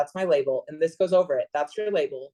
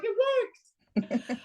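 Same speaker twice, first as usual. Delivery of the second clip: very high, softer squeak of delight; delighted surprise; 5 dB quieter than usual. Retold or imitated. The technique working, a soft high squeak of delight. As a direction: squeal it out softly, amazed. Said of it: "So I was like".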